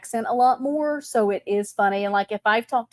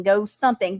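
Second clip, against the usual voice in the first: ordinary delivery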